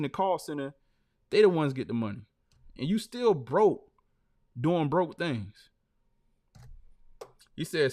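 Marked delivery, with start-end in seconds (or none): none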